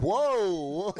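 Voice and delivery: high, loud drawn-out whoa; mock shock. Reacting to her going super dark, loudly shocked. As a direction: cry out as if the insult went too far.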